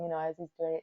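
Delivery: usual speaking voice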